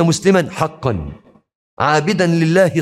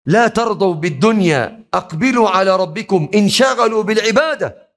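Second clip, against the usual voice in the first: rising exhortation; 8 semitones higher than usual. Real, not acted. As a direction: climb higher with each command. Sermon climbs. (نداء متصاعد بصوت أعلى)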